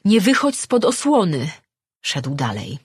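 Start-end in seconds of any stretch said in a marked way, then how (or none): 0.0-1.5 loud, high urgent warning; shouted warning. Acted. Woman warning him to stay shielded, shouting. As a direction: cry out to hold him back. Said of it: "krzyknęła".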